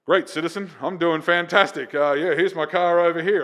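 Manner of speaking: hammed-up official heartiness; impersonating police officer. Comic bluffing as a cop to the wrecker driver, mock officer. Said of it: "I'm impersonating a police officer".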